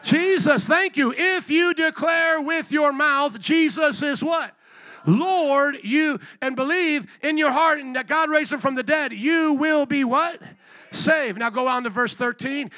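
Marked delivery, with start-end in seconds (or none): 0.0-11.4 loud, raised-pitch sermon delivery; emphatic call-and-response preaching; full-force preaching. Real, not acted. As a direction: drive the verse home to the congregation.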